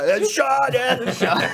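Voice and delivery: high, loud shout through laughter; retold calling out. Stuck in the broken table calling out, mock yell.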